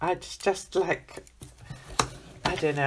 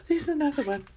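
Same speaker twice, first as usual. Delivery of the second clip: ordinary delivery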